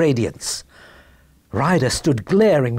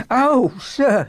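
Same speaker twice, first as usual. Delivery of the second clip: high, quivering exclamation; emotional cry; 9 semitones higher than usual. Acted. Little man hearing of his goose, emotional cry. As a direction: overcome little man crying out. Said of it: "Ryder quivered with emotion".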